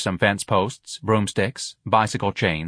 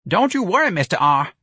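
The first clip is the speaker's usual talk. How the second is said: high, straining lifter's voice; effortful worker's call; panting; 9 semitones higher, 4 dB louder than usual. A narrator's reading. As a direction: reassure while straining under a load. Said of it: "a lifter panted".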